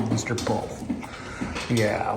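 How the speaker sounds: ordinary talk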